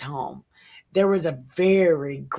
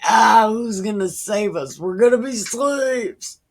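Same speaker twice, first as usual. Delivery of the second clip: whiny, higher, louder cry of doom; hammed-up terror of defeat; mock panicked Philistines; 6 semitones higher, 5 dB louder than usual. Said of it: "they're like".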